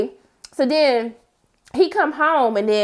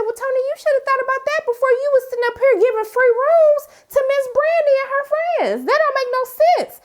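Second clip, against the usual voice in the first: much higher, scolding tell-off; sassy scolding; 13 semitones higher than usual. Retold or imitated. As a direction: lecture him like a fed-up auntie. Scolding Tony about parole excuse.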